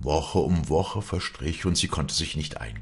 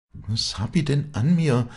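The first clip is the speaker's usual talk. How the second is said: higher, questioning dialect voice; puzzled wondering; 9 semitones higher, 4 dB louder than usual. A narrator's reading. Walli wondering why the lads go mad, puzzled. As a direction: wonder at it, pitched up.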